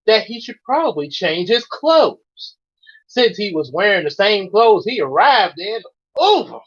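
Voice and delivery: plain reading voice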